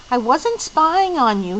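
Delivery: plain reading voice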